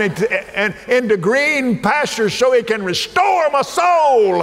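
high, loud, shouting preacher; hammering the promise home at full power; full-force preaching. Acted. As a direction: lift the room with a rousing shout.

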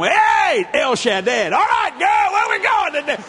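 high, loud happy dad shouting hello; excited greeting shout. Retold or imitated. Dad coming home shouting happily to the teens, excited. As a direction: burst in the door hollering for the kids.

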